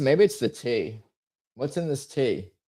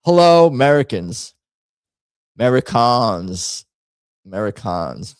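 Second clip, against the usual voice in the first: put-on British accent; 4 dB louder than usual. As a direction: greet the Americans like a pompous Brit. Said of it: "do the accent".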